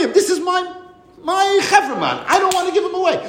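a master who won't let his slave go; protesting master. Retold or imitated. Master refuses to free his slave, high fast protest.

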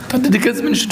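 high-pitched, hammed-up plea; acted tearful plea. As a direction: implore father as if starting to weep. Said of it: "du musst weinen". (געשפילט וויינענדיג, הויך און בעטנדיג)

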